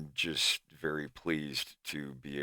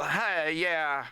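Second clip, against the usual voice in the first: higher, louder Southern drawl, just two words; Southern accent demo; 11 semitones higher, 3 dB louder than usual. Shown as a demonstration. Demoing the character's accent to the stream, higher and louder. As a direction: show off a different Southern accent. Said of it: "a different Southern accent".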